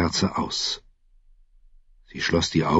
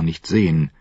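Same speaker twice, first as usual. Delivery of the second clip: ordinary delivery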